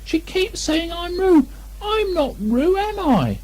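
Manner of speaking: very high, slow squeaky mock shout; a tiny pig trying to yell; Piglet's small outraged cry. Piglet pleading.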